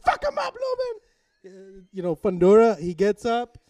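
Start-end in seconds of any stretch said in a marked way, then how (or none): 0.0-1.0 very high-pitched frantic fan yelling; carried away. Retold or imitated. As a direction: shriek like a fan egging on a boxer. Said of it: "I get carried away".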